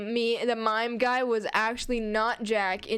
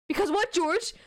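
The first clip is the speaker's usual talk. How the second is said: sharp angry question, higher; 7 semitones higher than usual. Acted. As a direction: snap it at him angrily. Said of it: "Martha says, extremely angry".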